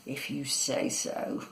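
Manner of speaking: low, soft, slow monster voice; quiet, low-pitched nastiness; nasty. A narrator's reading. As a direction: say it slowly and quietly, with a nasty grin. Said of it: "grinning nastily".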